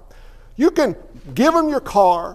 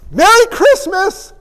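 much higher and louder, slow sing-song greeting; cheerful exclamation; 11 semitones higher, 7 dB louder, slower than usual. Pretend. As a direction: belt it out like a festive announcer. Mock holiday cheer.

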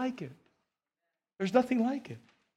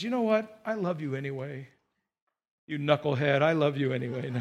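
softer and lower, fondly forgiving; tender God; 5 semitones lower, 3 dB quieter than usual. Acted. God answering an unfaithful believer, tenderly. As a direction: speak it gently to a knucklehead you love. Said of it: "And God says".

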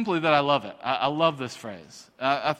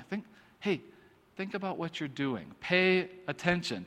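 much softer, halting "hey, think"; everyday admonishing; 7 dB quieter than usual. Shown as a demonstration. Saying the prophet's phrase as we would today, hushed admonishing. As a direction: quietly pull someone up short. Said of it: "We just say it a little differently".

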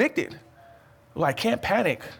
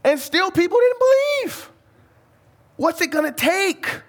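incredulous exclaim; 13 semitones higher than usual. Real, not acted. Preacher to congregation, crying out at stubborn unbelief. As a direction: throw your voice up in amazement.

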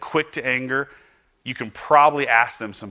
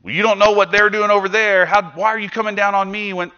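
defensive retaliation; 9 semitones higher, 5 dB louder than usual. Retold or imitated. Acted retaliation to criticism.

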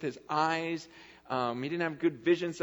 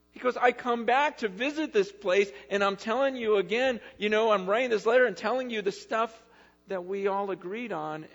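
higher and louder, pleading and stirred; impassioned Paul; 8 semitones higher, 5 dB louder than usual. Retold or imitated. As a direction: plead with old friends, fired up. Voicing Paul pleading with Galatians.

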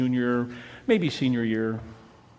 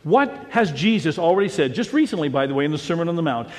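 ordinary talk

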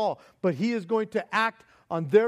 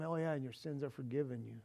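offhand aside; 6 semitones lower, 13 dB quieter, faster than usual. Retold or imitated. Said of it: "tell him".

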